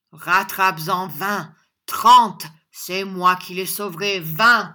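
loud, emphatic boasting; worked-up, forceful taunting; heated defiance. A narrator's reading. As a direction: goad him, punching out the numbers. John defiantly provokes Squeers in quarrel.

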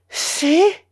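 fearful mother asking the bishop; trembling, breathless. Acted. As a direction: gasp it out, going pale. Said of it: "dit-elle en pâlissant, en tremblant".

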